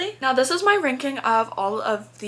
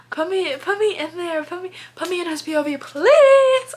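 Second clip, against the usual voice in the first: eager begging; 9 semitones higher, 6 dB louder than usual. Real, not acted. Begging to be in villain POV.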